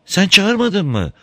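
high, slow woman's question; wife's voice. A narrator's reading. Wife answers husband.

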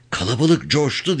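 usual speaking voice

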